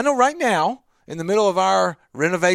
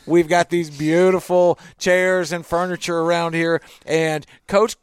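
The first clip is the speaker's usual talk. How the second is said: ordinary talk, nothing marked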